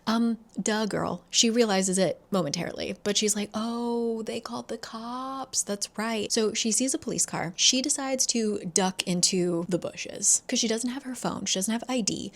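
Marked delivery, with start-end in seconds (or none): 3.5-6.3 soft, drawn-out "oh" of remembering; dawning realization. Retold or imitated. Eden seeing a car and remembering, softly realizing. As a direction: trail it out quietly as it sinks in.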